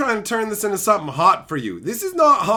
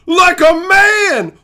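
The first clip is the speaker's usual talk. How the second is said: loud, high, drawn-out bellow; slow, shouted telling-off; mock scolding shout; 8 semitones higher, 9 dB louder than usual. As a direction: bellow it like a fed-up mob boss. Mock Godfather-style scolding.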